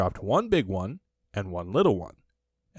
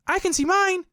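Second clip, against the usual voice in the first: very high, loud little kangaroo cry; high squeaky baby kangaroo; excited Roo cry; 5 dB louder than usual. A narrator's reading. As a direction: squeal it out like a tiny excited joey. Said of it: "cried Roo".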